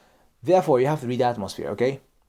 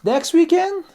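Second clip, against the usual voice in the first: much higher, drawn-out next weekend; polite reluctance; 5 dB louder, slower than usual. Shown as a demonstration. Colleague trying to be nice while declining, reluctant. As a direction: hide reluctance in a high polite question. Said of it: "He's still trying to be nice".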